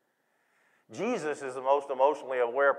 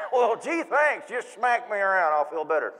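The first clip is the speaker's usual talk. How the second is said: put-on sarcastic kid; 5 semitones higher, 6 dB louder than usual. Child's sarcastic answer.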